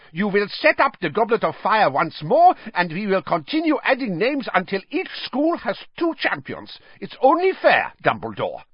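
hard demand. A narrator's reading. Karkaroff demanding a re-draw, ugly and insistent. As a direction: drop the charm and demand. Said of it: "a very ugly look indeed".

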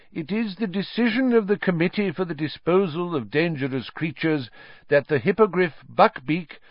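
high, formal, fussy official voice; official reading notice. A narrator's reading. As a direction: read the sentence like a nervous clerk.

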